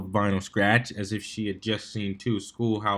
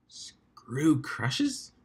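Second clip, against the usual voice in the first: whispered question; 3 semitones higher, 5 dB quieter than usual. A narrator's reading. Echoing, unsure question.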